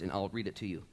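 ordinary talk, nothing marked

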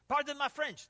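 loud, high outburst; mock yelling. Louis after choice words at teen, mock angry yell. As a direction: imitate an irate man excusing his swearing. Louis cursing in his rant.

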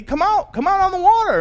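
high, loud beckoning call. Acted. Jesus inviting the fisherman out of the boat, calling. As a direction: summon him across the lake. Jesus calls Peter onto water.

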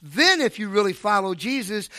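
ordinary talk, nothing marked

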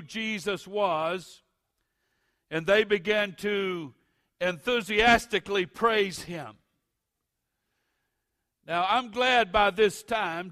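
loud, high, building preacher's cadence; preaching at full force. Acted.

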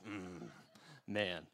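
low, soft mock-sulky grumble; sullen quiet. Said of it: "like, sitting quiet".

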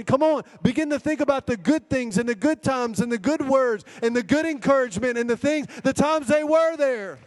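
high, driving preacher's exhortation; forceful preaching. Real, not acted. Preacher urging church to remember good times, forceful exhortation. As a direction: preach it high, slowing on the final phrase.

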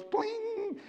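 mock bling sound. Sound effect for magic verse.

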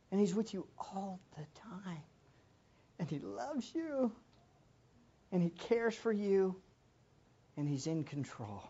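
soft, near-whispered comfort; hushed and tender; tender reassurance. Real, not acted. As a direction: reassure them gently.

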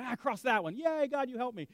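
high happy yay God; mock cheer. Believer thanking God for the job, mock joy. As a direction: celebrate like a relieved believer. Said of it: "And you go".

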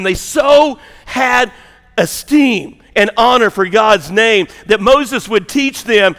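loud, high, fervent preaching voice; climbing pulpit fervour; full-force preaching. Acted. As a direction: preach it to the back pews.

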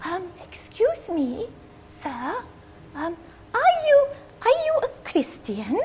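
put-on high, soft Mexican-accented lady voice; a light Mexican accent, high and hushed; sweet little Mexican lady. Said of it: "this sweet little Mexican lady says to him".

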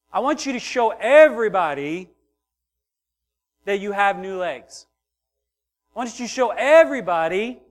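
high, loud fervent preaching; preaching at full force. Acted. Pastor urging the congregation, loud fervent preaching. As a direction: drive the point home from the pulpit.

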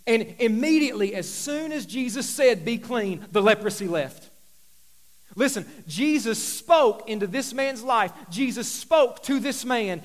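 loud, driving sermon build; impassioned pulpit delivery; full-force preaching. Acted. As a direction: preach it hard to the room.

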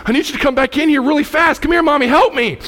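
put-on high, loud frightened child calling for Mommy; scared child. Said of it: "she got real scared".

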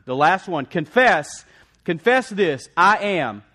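loud, high, driving sermon delivery; full-force preaching. Real, not acted. Preacher rallying the congregation, loud and fervent. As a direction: preach it out, pounding each declaration.

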